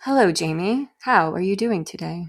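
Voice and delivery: measured robotic greeting; synthetic-sounding polite robot. Shown as a demonstration. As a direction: talk like a computer assistant. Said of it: "was to do the robot voice".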